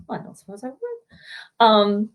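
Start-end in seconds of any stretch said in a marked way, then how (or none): 0.1-0.8 very soft, timid reply; meek pupil. Retold or imitated. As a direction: shrink away as you answer, barely audible. Cowed pupil answers angry teacher.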